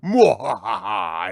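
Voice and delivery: slow, loud, drawn-out villain cackle; evil villain laugh. Acted. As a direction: cackle like a mustache-twirling villain. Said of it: "twisting his evil curled mustache and saying".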